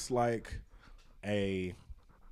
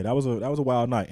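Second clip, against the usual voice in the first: ordinary delivery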